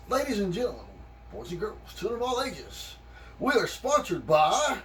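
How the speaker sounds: showman sponsor call; announcer voice. Acted.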